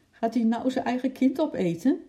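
high-pitched, alarmed question; worried child's high voice; anxious. A narrator's reading. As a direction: ask in rising alarm, pitched well up. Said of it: "vroeg Lotje angstig".

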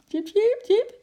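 high, loud, happy little animal squeak; cheerful chirping. A narrator's reading. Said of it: "piepte Eki vrolijk". (vrolijk, hoog gepiep)

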